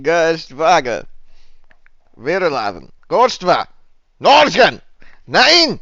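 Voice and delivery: fake German. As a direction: bark made-up German words. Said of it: "play this first little bit in just fake German".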